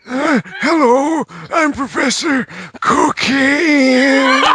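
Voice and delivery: very high, very slow cartoon professor breaking into laughter. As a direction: squeak it out slowly while cracking up. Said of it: "With that voice?".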